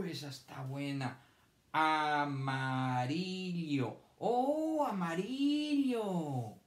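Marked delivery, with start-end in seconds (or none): 1.7-4.0 AMARILLO drawn out very slowly, syllables linked. Shown as a demonstration. Teacher sounding out amarillo for children to count, syllable by syllable. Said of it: "¿Cuántas sílabas tiene?". 4.2-6.5 high, drawn-out exclamation; delighted discovery. Pretend. Teacher reacting to children's answer, delighted.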